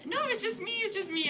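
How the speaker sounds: high, light imitation of a friend; high-pitched retold reassurance; breezy denial. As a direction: brush off the question in a high voice. Retold friend denying he is there.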